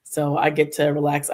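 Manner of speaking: ordinary delivery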